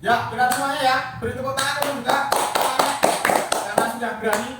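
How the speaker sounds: loud, high-pitched congratulation; excited praise. Real, not acted. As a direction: call out praise to the whole class. Teacher praises pupil, calls for applause.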